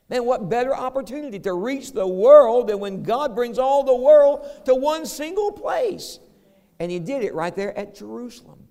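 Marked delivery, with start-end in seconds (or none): 0.1-6.2 louder and higher, full preaching fire; fervent preaching shout. Real, not acted. Preacher rousing the congregation, loud and higher. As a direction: lift the whole room at the peak. Preacher's climax on God's plan.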